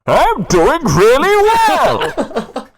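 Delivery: booming, high, drawn-out villain shout; absurdly loud, stretched-out villain voice; Bane. Retold or imitated. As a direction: blast it out slowly like an overamplified masked villain. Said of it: "'Cause it was loud".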